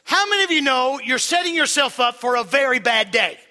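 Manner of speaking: loud, driving preaching; preaching at full force. Acted. Pastor drives his point home to the congregation, loud. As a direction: preach it hard to the crowd.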